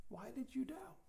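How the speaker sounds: whispered question; hushed whisper of Jesus to Peter. Acted. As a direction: breathe it out as a hushed plea. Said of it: "He's saying".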